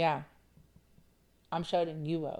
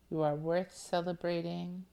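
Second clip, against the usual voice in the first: ordinary talk, nothing marked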